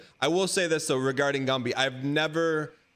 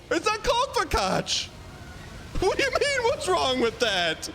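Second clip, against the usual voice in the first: much higher, disbelieving outburst; incredulous; 9 semitones higher than usual. Real, not acted. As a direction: squeal in disbelief at the word. Incredulous outburst about fakach.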